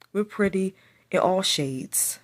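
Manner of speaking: ordinary delivery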